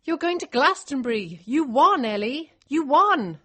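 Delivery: high, loud jubilant news; excited. Acted. Liz tells the winner she's going to Glastonbury, jubilant. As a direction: celebrate every phrase.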